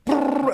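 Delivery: short, loud, high rolling brrr; gun burst noise. Retold or imitated. Showing how the AK sounded on full auto, rolling gun noise. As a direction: roll it like a rifle burst.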